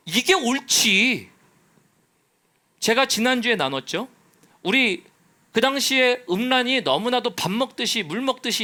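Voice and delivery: full-force preaching. Acted. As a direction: preach it hard to the congregation. (높고 큰 목소리로 열정적인 설교)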